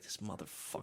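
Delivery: very soft, low disgusted mutter; imitated grumble. Imitating Howard seeing her boyfriend come by, grumbled. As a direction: mutter it under your breath.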